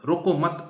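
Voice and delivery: रोको मत joined, loud and high; pause after मत. Shown as a demonstration. Teacher repeating the second reading, रोको मत bound together. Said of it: "उसके बाद कॉमा लगा दी".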